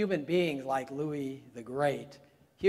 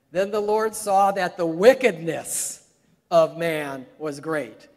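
emphatic scripture; 2 semitones higher, 10 dB louder, slower than usual. Retold or imitated. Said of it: "but Wiersbe said".

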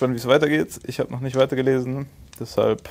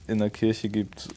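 ordinary talk, nothing marked

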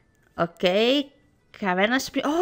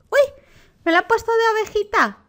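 excited squeal; 10 semitones higher, much faster than usual. Real, not acted. Delighted by sheep outfit.

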